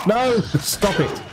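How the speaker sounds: drawn-out shout at the attacking boss; real shout, slowed and stretched; slow panicked shout. Real, not acted. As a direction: shout it slowly, pleading it to stop.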